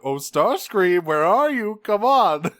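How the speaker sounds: gleefully menacing Megatron hunting Starscream; taunting Megatron. Retold or imitated. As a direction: call out like a villain hunting his prey. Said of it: "I was like".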